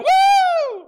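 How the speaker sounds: loud, high whoop of joy. Retold or imitated. Teen whoops with his friend over the new job, loud and high. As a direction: whoop like you just got the job.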